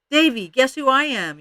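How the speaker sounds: loud, high eager call; yelled question. A narrator's reading. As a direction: call it out loudly across the playground. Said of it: "yelled Ramona".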